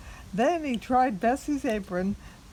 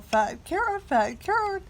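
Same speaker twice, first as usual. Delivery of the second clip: high, sing-song coaxing of a cow; 7 semitones higher than usual. Acted. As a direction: tempt her with the carrot, up high.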